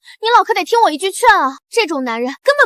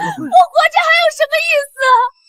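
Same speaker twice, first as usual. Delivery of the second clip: pitched up, sobbing lament; tearful wail; 6 semitones higher than usual. Acted. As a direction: wail as if life is over. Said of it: "这女人哭得这么惨". (哭着哀嚎，声音拔高)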